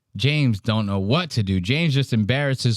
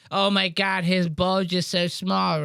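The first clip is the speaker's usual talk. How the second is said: mocking classmates; 6 semitones higher, slower than usual. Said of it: "everybody's going".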